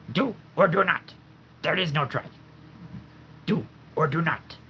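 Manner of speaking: high, odd Yoda voice. Shown as a demonstration. Doing Yoda for the viewers, in a high Yoda voice. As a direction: croak it out like little old Yoda. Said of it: "I'll try to do Yoda".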